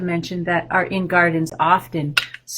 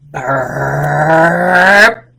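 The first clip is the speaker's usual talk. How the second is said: long, loud, lower spoken burp; big mock burp; 6 semitones lower, 6 dB louder than usual. Teacher acts the boy's huge burp for the kids. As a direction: belch the word out big and silly.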